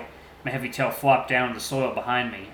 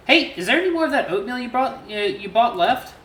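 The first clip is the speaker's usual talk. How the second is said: gentle T J voice; 11 semitones higher, 4 dB louder than usual. A narrator's reading. T J asks about the oatmeal in his high voice. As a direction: voice the friendly lynx lightly. T J's character voice.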